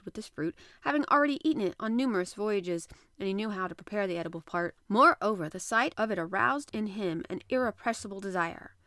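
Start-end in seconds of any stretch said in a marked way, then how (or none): none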